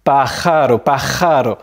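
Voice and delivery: pájaro with throaty kh then a light tapped R; guttural J, simple R. Shown as a demonstration. Teacher showing the hard combination, pájaro said PA-kha-ro. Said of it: "à la fois le r du J et le R".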